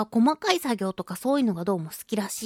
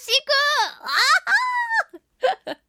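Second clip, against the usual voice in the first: mock sob; 4 dB louder, much slower than usual. Fake sob over the duplicate gift.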